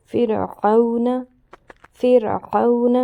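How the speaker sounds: فرعون read slowly and loudly, with a thin ر; careful Quran recitation. Shown as a demonstration. Teacher drilling the thin ر, loud careful reading. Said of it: "سارا focus را پر ہونا چاہیے".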